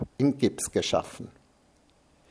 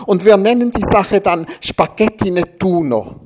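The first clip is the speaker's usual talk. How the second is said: whining on; 8 semitones higher, 7 dB louder than usual. A narrator's reading. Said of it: "jammerte er".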